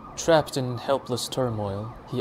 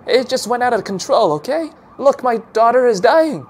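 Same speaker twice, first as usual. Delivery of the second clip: high, loud desperate plea; desperate pleading; 12 semitones higher, 9 dB louder than usual. A narrator's reading. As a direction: beg them to understand.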